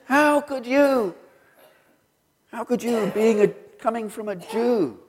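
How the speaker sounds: high, slow, pained demand of the father; mock angry father. Son retelling dad's outrage at his conversion, a high slow mock anger.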